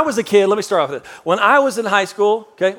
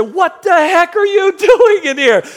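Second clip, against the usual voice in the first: high, loud, slow outraged yell with a laugh; angry shout; 11 semitones higher, 5 dB louder, slower than usual. Retold or imitated. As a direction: let it burst out. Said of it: "and I said".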